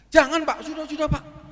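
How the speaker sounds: tinggi dan keras, menepis; penolakan heboh. Retold or imitated. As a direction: tolak dengan sungkan yang didramatisasi. Menolak potongan harga dengan heboh. (penolakan heboh bernada tinggi)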